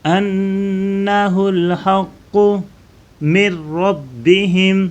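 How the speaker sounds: من ربہم joined as مرربہم with no ن heard, slow; nun merged. Shown as a demonstration. Teacher shows the merged reading to students, slow recitation. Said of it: "میم کو را کے ساتھ ملائیں".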